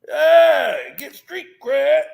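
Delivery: loud hype; hype call. Pretend. Hyping street cred, loud call. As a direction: shout it out.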